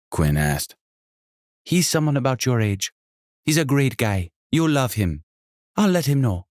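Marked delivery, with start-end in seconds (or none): 1.6-6.5 high, lively hostess voice with a faint Jamaican lilt; a welcoming, bubbly landlady; energetic innkeeper. Acted. Sonia about Titus.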